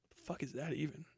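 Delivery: ordinary talk, nothing marked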